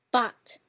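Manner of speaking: "but" as a firm fourth tone; falling tone. Shown as a demonstration. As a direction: punch "but" downward. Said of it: "Let's say it again".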